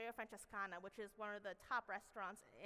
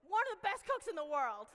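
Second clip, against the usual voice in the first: much higher and louder, hyped up; big proud announcement; 7 semitones higher, 10 dB louder than usual. Shown as a demonstration. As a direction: sell it like an announcer introducing a champion.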